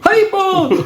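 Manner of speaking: high loud repeat of the cry; loud call. Retold or imitated. Guru shouting at the visitor, high loud call. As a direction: shout it again, high and bright. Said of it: "very loudly".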